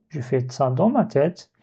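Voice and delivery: ordinary talk, nothing marked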